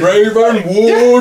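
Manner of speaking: high, slow, mouth-open cry; dramatic cry. Acted. Host acting the sweater picture, a dramatic cry. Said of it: "With mouth open".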